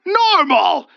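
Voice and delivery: loud high scoffing cry. Acted. The wraith scoffing at Olga's word. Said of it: "it scoffed and jabbered".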